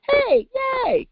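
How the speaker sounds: sudden squeaky high cheer. Acted. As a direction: celebrate them with a bright yay. Cheering for circle members.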